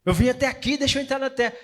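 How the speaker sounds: a lightly voiced Moses begging; Moses pleading. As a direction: beg to be let in. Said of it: "Moisés implorou pra Deus".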